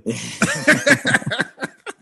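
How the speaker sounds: squealing laugh; sudden high-pitched laugh; high burst of laughter. Real, not acted. Laughing at French accent offer.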